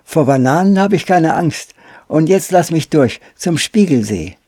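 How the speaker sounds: put-on high, loud, cocky boy's voice; Pole Pole, amused defiance. A narrator's reading. Boy laughs off the banana weapon, amused defiance. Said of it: "Pole Pole lachte laut auf".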